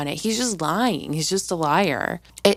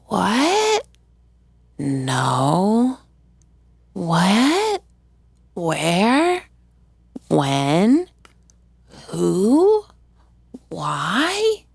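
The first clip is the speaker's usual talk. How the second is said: shocked disbelief; 3 semitones higher, much slower than usual. Retold or imitated. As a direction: fire off each question in mock disbelief. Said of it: "And Jenny's like".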